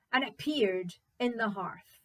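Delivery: ordinary delivery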